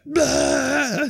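mock retching blah; retching noise. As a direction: fake a drunken heave. Imitating being sick drunk.

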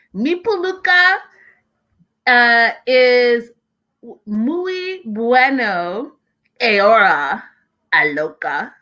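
slow, hammed-up Spanish the way Karen said it; imitating Karen's Spanish. Vlogger mimics Karen's reality-show Spanish, hammed up. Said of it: "I'm gonna try to say it exactly how Karen said".

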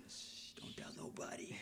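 playful hushing whisper; mock secretive whisper. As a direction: shush them and whisper like a conspirator. Playful keeping of family secrets.

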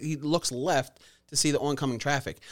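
usual speaking voice

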